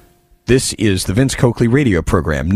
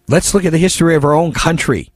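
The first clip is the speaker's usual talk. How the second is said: ordinary delivery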